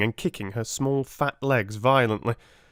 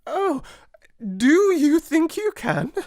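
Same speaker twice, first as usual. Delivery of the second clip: much higher, louder, slow gasp; gasping Miss Amelia; 7 dB louder, slower than usual. A narrator's reading. Miss Amelia desperate for help, high gasping plea. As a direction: gasp in hopeless relief. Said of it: "she gasped".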